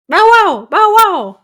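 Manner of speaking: high, loud yapping barks; playful mock barks; barking dog. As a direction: bark like a small dog. Playing the dog.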